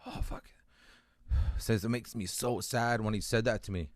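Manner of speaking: hushed and sad, letting out a sigh; emotional. Real, not acted. Recalling a troubled friend's confession, emotional and hushed. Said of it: "I'm sorry for getting emotional here".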